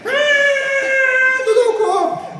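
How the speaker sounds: very high, loud tantrum shout; child screaming. Retold or imitated. Child throwing a tantrum at dad in the market, loud high scream. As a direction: scream it like a kid on the floor. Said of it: "começar a girar e gritar".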